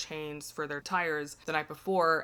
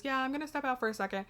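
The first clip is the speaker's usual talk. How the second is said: hurried excuse; 9 semitones higher, much faster than usual. Retold or imitated. Fake Charlie hurrying out.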